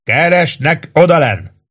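high called question; calling out. A narrator's reading. Chief Choate calling up to the roof. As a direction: call it back up.

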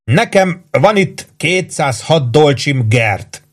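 mildly gushing enthusiasm; bubbling over. A narrator's reading. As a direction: announce it with relish, drawn out. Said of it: "mondta buzogva a Warden".

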